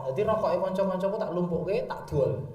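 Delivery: usual speaking voice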